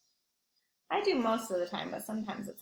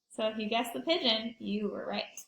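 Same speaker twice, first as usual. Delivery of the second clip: ordinary delivery, nothing marked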